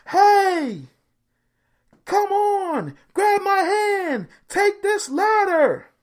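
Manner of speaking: high, loud, slow yell; yelling over rotors. Retold or imitated. Chopper rescuer yells to the man on the branch, loud. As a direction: shout over the helicopter's roar.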